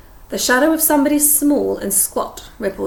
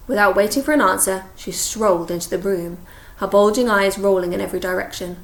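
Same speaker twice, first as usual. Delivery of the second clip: ordinary delivery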